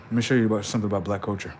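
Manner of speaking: usual speaking voice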